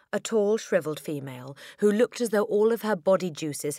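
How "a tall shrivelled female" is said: usual speaking voice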